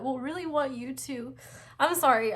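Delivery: tearing up mid-sentence, can't hold it; crying. Real, not acted. Reader to viewers of pile four, crying. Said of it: "I'm, like, crying".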